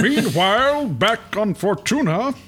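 comic narrator voice. Acted. Mock comic narrator announcement.

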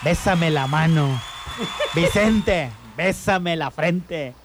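slow, solemn dying father; mock deathbed voice. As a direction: call each son, drawing out the request. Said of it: "Un padre antes de morir llama a sus hijos".

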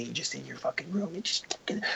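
whispered mimicry of a parent; mocking under breath. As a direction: whisper mockingly, as if alone after being told off. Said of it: "you're just, like, mocking them".

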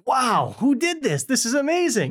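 high astonished gasp of wonder; mock amazement. New husband discovering the garden, hammed-up amazement. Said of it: "he's like".

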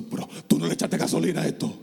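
ordinary delivery

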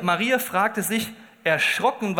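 ordinary talk

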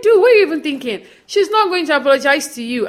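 high, loud, disbelieving retort; incredulous mock outburst. [name] cutting Austin down, high incredulous outburst. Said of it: "It's like".

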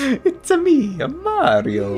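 high cartoon Italian plumber voice; bouncy Mario catchphrase.

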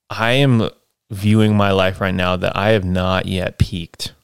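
normal talking voice

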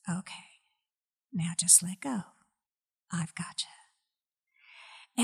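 soft, very slow whispered comfort; God tenderly tells the tired mother to let go; tender reassurance. Retold or imitated. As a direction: gently let her know she is held. God's gentle words in vision.

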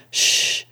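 a drawn-out, voiceless "shh"; warning shush. A narrator's reading. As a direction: hush the room in warning. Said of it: "interrupted with a warning".

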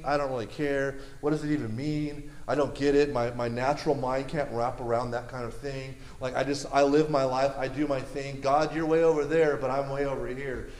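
low, softening, indifferent delivery; apathetic. Acted. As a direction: shrug it all off, keeping your distance. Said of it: "people that just are apathetic".

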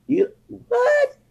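high squeaky mock disbelief; mock shocked squeak. Mocking a stranger shocked he speaks Spanish, high squeak. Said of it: "but it's like".

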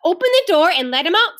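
firm order. A narrator's reading. Mother tells Ramona to free the dog, firm order. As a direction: give a firm instruction.

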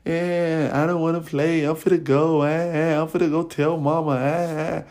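hammed-up whining to tell mama; mock crying. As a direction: mimic a crybaby whimpering. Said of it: "making, like, little crying noise".

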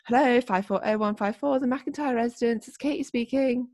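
singsong, formal phone recital; Katie answering phone. Retold or imitated. Imitating best friend answering parents' phone, formal singsong. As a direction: reel off the dutiful phone greeting. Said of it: "what my best friend used to say".

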